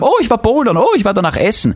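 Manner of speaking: high, mocking Instagram bragging; mocking show-off voice. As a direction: parody a show-off posting stories. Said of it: "Story postet hab".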